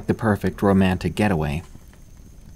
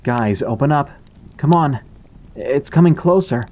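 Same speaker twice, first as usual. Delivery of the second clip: rising from worried to frightened, louder and higher; a scared boy begging at the door, growing frantic; 6 semitones higher, 4 dB louder than usual. A narrator's reading. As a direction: panic building as the thing gets closer. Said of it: "He sounded scared".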